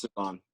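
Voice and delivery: "Devon" said duh-VON; "Devon" as duh-VON, giving one's own name; correct name pronunciation. Shown as a demonstration. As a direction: correct "Devon" with stress on the second syllable. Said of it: "how do I pronounce it".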